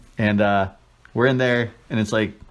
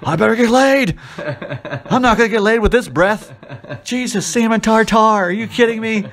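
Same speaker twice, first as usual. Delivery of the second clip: much higher, louder mock horror with laughter; inner disgusted rant; 11 semitones higher, 4 dB louder than usual. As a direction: voice the panicked thoughts behind a polite smile. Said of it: "in my mind, I'm like".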